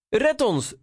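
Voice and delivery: very high storybook call; cry for rescue. A narrator's reading. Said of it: "riep Eduard". (hoge noodkreet)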